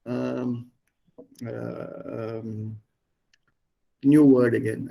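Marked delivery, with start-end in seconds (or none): none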